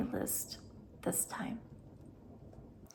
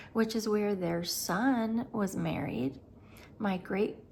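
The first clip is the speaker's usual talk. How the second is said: ordinary talk, nothing marked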